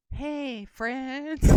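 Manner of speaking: seductive greeting in an attempted Texas twang, loud finish. Host close to the camera giving a clue, seductive Texas twang impression. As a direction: purr an impersonated hello up close in a Texas twang. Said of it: "that was very seductive".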